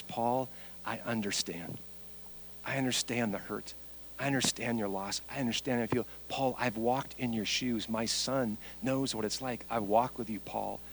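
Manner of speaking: low, hushed, tender comfort; gentle reassurance. Acted. Preacher voicing God consoling Paul, tender and quiet.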